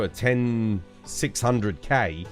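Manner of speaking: ordinary talk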